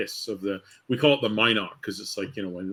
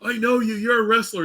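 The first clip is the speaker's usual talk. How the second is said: put-on drunk fan; 12 semitones higher, 4 dB louder than usual. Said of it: "he's like".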